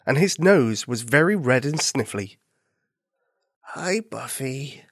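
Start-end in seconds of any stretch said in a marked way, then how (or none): 3.7-4.8 slow, soft, sick and miserable. Acted. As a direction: drag it out, feeling awful. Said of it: "sounding miserable".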